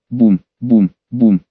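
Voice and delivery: low, heavy booms like footsteps; booming onomatopoeia. A narrator's reading. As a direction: boom it out like a giant stomping. Narrator voices giant footsteps. (низкое гулкое «бум»)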